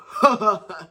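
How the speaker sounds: scoffing laugh. Real, not acted. Reacting to rich people cheating better, laughing. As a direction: laugh after an "oh".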